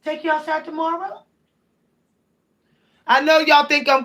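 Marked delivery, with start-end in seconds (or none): 0.0-1.2 soft high coo to a pet; sweet pet voice asking a question. Real, not acted. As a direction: coo gently to the cat. Talking to her cats.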